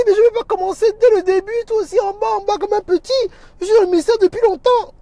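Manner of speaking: high, loud, huffy protest; mock indignant caller. A proud pastor protests he will not start at the bottom. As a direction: play the offended veteran minister.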